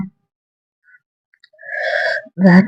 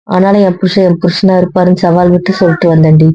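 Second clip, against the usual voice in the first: ordinary talk, nothing marked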